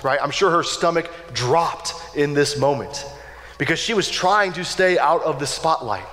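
ordinary talk, nothing marked

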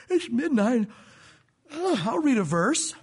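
drawn-out, weary groaning delivery; mock exhaustion. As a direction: drag the words out, hammed-up sleepy. Acting worn-out believer at midnight.

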